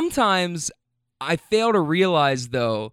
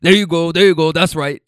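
put-on louder, in a light South American accent; 6 dB louder than usual. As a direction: cheer him on with a mock South American accent. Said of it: "so I'm trying to do the accent".

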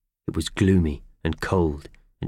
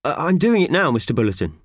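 flustered; 8 semitones higher, 4 dB louder than usual. Acted. Clerk flustered before his boss.

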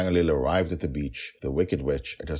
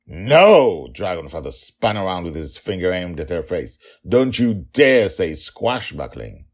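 loud, high furious father; angry shout; 3 semitones higher, 9 dB louder than usual. Acted. Father warns the witch.

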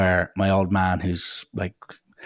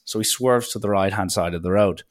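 ordinary talk, nothing marked

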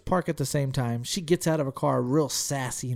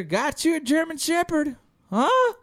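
spring it as a mock-proud surprise; pushy gift-giver; 13 semitones higher than usual. Janetta shows up with an unwanted dog, mock-eager.

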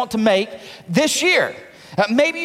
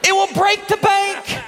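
mock outraged shout; 7 semitones higher, slower than usual. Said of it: "I'm like".

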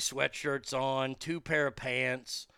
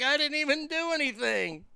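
much higher, disbelieving whine; incredulous protest; 11 semitones higher than usual. Retold or imitated. Narrator protesting to the patient flipping him off, high and incredulous. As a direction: protest your innocence in disbelief. Indignant at double middle finger.

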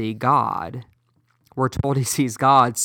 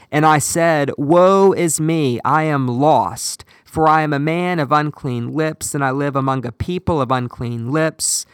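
"woe is me" boomed out with force; dramatic scripture reading; 2 semitones higher, 4 dB louder than usual. Acted.

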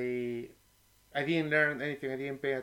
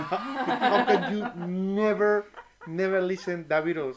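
incredulous; 6 semitones higher, 7 dB louder than usual. Retold or imitated. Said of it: "I said".

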